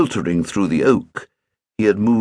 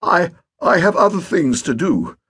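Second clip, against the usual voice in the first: higher, stammering excuse; recoiling; 9 semitones higher than usual. A narrator's reading. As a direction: back out quickly. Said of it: "recoiling from the prospect".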